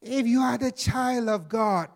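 high, sly tempter voice; sinister devil voice. Acted. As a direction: tempt him like a scheming devil. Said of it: "I'm using my sinister voice again".

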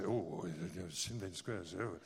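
dropped low and soft, hesitant and uneasy; mock wary Singaporean. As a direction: imitate someone wary of the place. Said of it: "I find Singaporeans say".

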